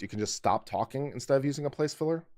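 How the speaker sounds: real unintended uptalk, statement ending high. Real, not acted. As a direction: pitch climbing to a high rise on "filler". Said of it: "I just did the up talk again".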